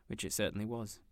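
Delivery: ordinary delivery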